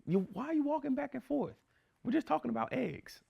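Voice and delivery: high, soft imitation of the fiance; fiance's voice. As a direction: play the fiance gently telling him to calm down. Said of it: "She's like".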